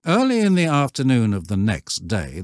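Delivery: ordinary delivery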